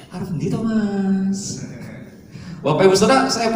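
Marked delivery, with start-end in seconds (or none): 0.1-1.6 slow, soft Central Javanese question; unhurried, softer Central Javanese ask. Retold or imitated. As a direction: ask it gently, the polite Central Java way. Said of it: "orang Jawa Tengah".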